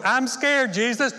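high, loud fearful plea; hammed-up scared plea; mock fear. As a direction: beg Jesus, frightened and clinging. Refusing to give Jesus controls.

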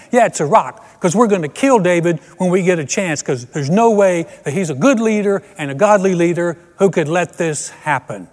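angry Nebo. Acted. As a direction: bluster like a mob ringleader. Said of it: "my name is Nebo".

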